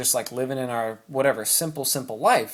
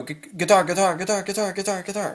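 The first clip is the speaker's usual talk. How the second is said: higher, quick-fire repeats of "guitar"; rapid repetition; 5 semitones higher than usual. Shown as a demonstration. Coach showing chosen speed and repetition, rapid-fire chant. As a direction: rattle off "guitar" over and over without a break. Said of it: "the repetition. You can go".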